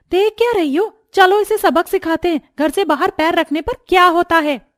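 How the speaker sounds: high-pitched, fired-up threat; shrieky villainess urging an attack; menacing rallying. Acted. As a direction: egg the others on to punish her. Rallying the others to beat her.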